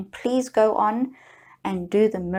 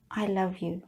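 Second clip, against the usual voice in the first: soft tender; 2 semitones lower, 7 dB quieter than usual. Shown as a demonstration. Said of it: "you would be talking to yourself in this manner".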